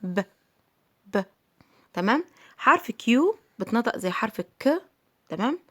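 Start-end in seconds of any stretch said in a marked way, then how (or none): 0.0-1.2 ب as a short puffed "puh"; sound of P. Shown as a demonstration. Teacher showing P's sound versus name, short puff. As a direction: isolate ب as a p sound, repeated with a pause. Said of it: "ولكن صوته".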